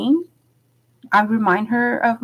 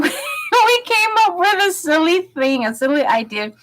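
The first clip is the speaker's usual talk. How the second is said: higher, laughing through the words; 9 semitones higher than usual. Real, not acted. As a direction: giggle at the memory. Laughs recalling silly plan.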